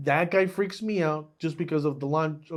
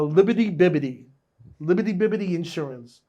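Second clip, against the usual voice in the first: insurance jingle; 7 dB louder than usual. Retold or imitated. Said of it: "actor going".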